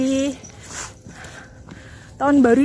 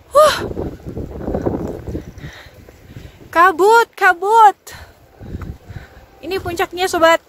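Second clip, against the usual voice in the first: raised voice against strong wind; shouting over wind; 4 semitones higher, 4 dB louder than usual. Real, not acted. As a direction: call out loud over the gusts. Windy foggy summit, strong wind.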